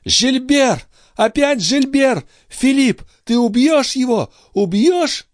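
very high, agitated woman's voice; frantic plea. Acted. Sister begs brother to kill her attacker, high and frantic. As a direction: cry the name, then plead on a high pitch.

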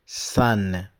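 "san" said with an open a vowel; "san", like the American "son"; teacher shows how the word sounds; pronounced san. Shown as a demonstration. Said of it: "on prononce".

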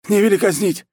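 very high, loud begging; yelled plea. Acted. War chief begs not to be executed, high loud yell. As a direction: yell out for mercy. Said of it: "снова заорал военачальник".

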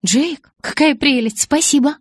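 high, loud, effusive thanks; gushing delight. A narrator's reading. Bella thanks Jacob for his present, gushing delight. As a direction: gush over the gift, pitch way up.

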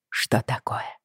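tense frightened whisper; испуганный шёпот. A narrator's reading. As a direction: whisper it in alarm. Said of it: "испугалась я". (испуганным шёпотом)

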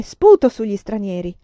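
loud, forceful cry; grido. A narrator's reading. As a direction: cry it out to convince your captors. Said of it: "gridò infine". (grido forte e deciso)

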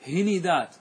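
هندات as hi-ni-dat; three syllables in هندات, "i" twice; vowel-echo plural. Shown as a demonstration. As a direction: copy the "i" onto the ن in هندات. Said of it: "تتبع الحرف الثاني حركة الحرف الأول".

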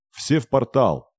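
urgent yell over the wind; shouting. Acted. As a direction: shout them toward the portal. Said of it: "стараясь перекричать рёв урагана".

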